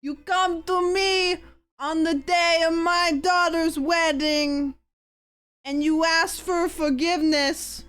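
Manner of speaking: put-on slow, high Greek mob-mother accent. A Greek rich mother scolding her child, slow Greek accent. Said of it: "This is a Greek accent".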